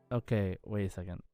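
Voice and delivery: ordinary delivery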